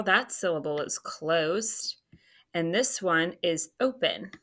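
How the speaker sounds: ordinary talk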